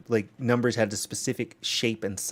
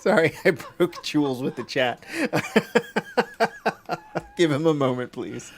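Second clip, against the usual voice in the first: laughing; 7 semitones higher than usual. Real, not acted. Cracking up at the chat.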